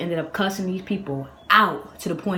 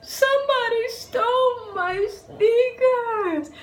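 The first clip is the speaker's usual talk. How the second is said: very slow, high-pitched tearful bawl; hammed-up childish sobbing complaint; mock crying wail; 4 dB louder, much slower than usual. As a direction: bawl it like a heartbroken kid. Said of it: "the tears coming down".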